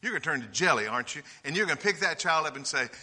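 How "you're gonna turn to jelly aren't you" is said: normal talking voice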